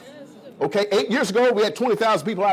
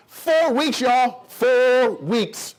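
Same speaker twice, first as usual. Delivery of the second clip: higher, very slow stress on four weeks; drawn-out emphasis; 5 semitones higher, much slower than usual. Real, not acted. Selling the training to the crowd, drawn-out emphasis.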